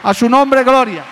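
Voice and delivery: loud, high cry of glory; shouted praise. Real, not acted. Preacher shouting praise to the congregation, loud and high. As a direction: call out his name in praise.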